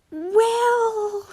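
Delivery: high, drawn-out well; hesitant Draco. Acted. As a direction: stretch it out, stalling. Draco bracing for the worst.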